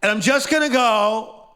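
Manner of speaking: high, loud put-on declaration; hammed-up self-important announcement; mock seeker. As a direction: imitate someone grandly announcing an escape. Imitates people seeking a revelation.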